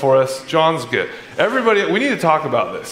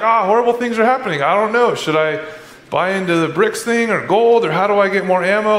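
higher, fast mock panic; freaking out; 5 semitones higher than usual. Believer fretting about the news, hammed-up and frantic. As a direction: rattle off the worries in a frantic rush. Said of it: "we're like / I'm freaking out".

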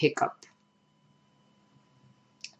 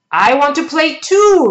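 put-on much higher, loud roar of a boy; 10 semitones higher, 7 dB louder than usual. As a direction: roar it like a monster wanting to play. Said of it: "roared Anthony".

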